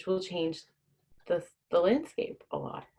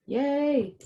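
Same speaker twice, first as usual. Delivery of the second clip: cheer; 11 semitones higher than usual. Real, not acted. Cheering at stream start.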